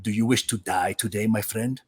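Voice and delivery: low, soft, menacing voice; a quiet threat from the stranger in line. Retold or imitated. Stranger at Burger King threatens the speaker, low menacing voice. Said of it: "and he goes".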